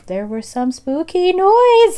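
high, slow mock spooky. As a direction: ham up the spooky line. Mocking weak ghost stories.

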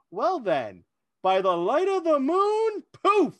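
mock-grand fantasy game master signing off; dramatic in-character exit. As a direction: intone it like a wizard vanishing. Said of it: "really in character".

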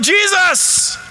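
high, drawn-out yell of a name. Acted. Blind beggar calling to Jesus, yelling. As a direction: call out high over a crowd. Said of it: "irritated by this guy yelling".

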